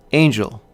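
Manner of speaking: "angel" stressed on the first syllable; trochaic stress. Shown as a demonstration. Lecturer giving an example of trochaic rhythm, "angel" stressed on "an". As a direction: say "angel" strong then weak. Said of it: "hear the rhythm of the trochee".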